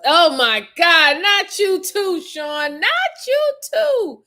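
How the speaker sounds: slow, high, drawn-out disbelief; mock disbelief. Real, not acted. Reacting to viewer Sean's confession, slow and high. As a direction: stretch it out, scandalised.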